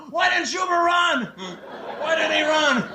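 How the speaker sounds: high, bellowing coach shout; coach yelling. Retold or imitated. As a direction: bark it across the field like a gym coach. Coach shouting about the kid.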